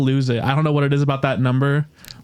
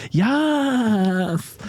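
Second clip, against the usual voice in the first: a touch of camp cheer; 8 semitones higher than usual. Retold or imitated. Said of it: "they just go".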